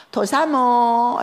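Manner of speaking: loud, very slow drawn-out call; mother calling from the kitchen, drawn-out and loud; pointed call. Retold or imitated. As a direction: stretch each syllable out sarcastically. Mother calls her pointedly from kitchen.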